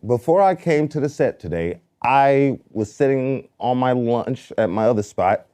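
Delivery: ordinary delivery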